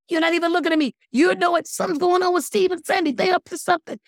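high, loud accusing gossip; nosy friend, high and loud; excited gossip. Acted. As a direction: burst out high and loud with suspicion. Acting out suspicious gossip reaction.

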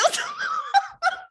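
a real, high-pitched burst of laughter. Real, not acted. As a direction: let the laugh break out high and free. Laughing at her own story.

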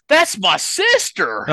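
put-on Southern Missouri accent, offended and dragged out. Brother corrects the comedian, indignant Southern Missouri accent. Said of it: "he said it with the accent and everything".

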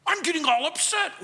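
high-pitched mock upset; angry objector. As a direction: play someone getting worked up. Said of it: "they're so angry with you".

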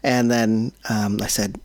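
ordinary talk

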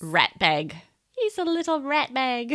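playful put-on Kiwi accent; imitated New Zealand accent. Co-host quotes Kiwi lady about her baby, imitated New Zealand accent. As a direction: mimic a Kiwi colleague. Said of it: "work with this Kiwi lady".

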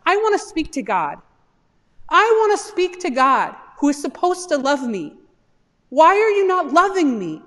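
rising anguished plea. Acted. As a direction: plead harder with each line. Job insists on speaking to God.

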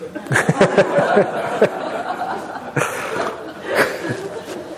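airy laugh rising high; breathy laugh. Real, not acted. Monk laughing at the same thing punchline. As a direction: let a soft breathy laugh out.

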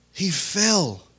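normal talking voice

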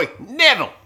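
high, loud Australian caricature; mock Australian accent. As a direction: exaggerate the Australian vowels loudly. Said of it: "No, Australia".

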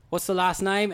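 imitated British concierge; bad British accent. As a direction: put on a shaky British accent. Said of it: "how bad my accent is".

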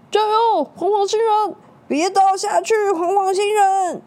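high, loud crowd cheer. Acted. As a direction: cheer him not to fall.

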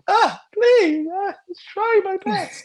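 high-pitched mock whimper; hammed-up, high wounded pleading; offended whiner. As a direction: squeal it like someone hurt and pleading. Mimics offended person whining back.

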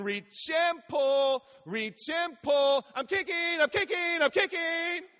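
excited, high-pitched belted chant; top-of-lungs child shout. Retold or imitated. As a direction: imitate a little girl yelling her swim routine. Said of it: "at the top of her lungs".

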